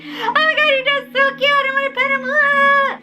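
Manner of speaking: very high-pitched, mock fawning over a dog; imitated gushing squeal. Imitating mall shoppers adoring the dog, high excited squeal. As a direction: squeal it like a crowd of dog lovers.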